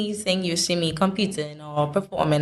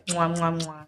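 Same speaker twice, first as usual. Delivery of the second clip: quick playful "mwah" kisses; blown kisses; 3 dB quieter than usual. Pretend. Guest making up to teased Bajans, playful kiss noises. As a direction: smack out three little mock kisses.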